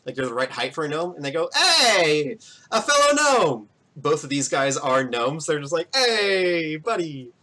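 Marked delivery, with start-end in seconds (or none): 1.5-3.7 high, loud drawn-out hail; jolly gnome cheer; excited gnome greeting. Acted. 5.9-7.3 drawn-out hail starting high, then dropping; lightly hammed-up gnome hello; friendly gnome greeting.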